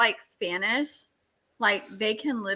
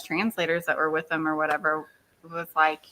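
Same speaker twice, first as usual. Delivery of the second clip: normal talking voice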